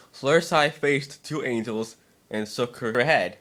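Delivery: usual speaking voice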